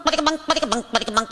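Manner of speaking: plucky mouthed banjo picking; vocal banjo riff; banjo imitation. Shown as a demonstration. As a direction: bounce the syllables like banjo strings. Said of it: "let me be your banjo".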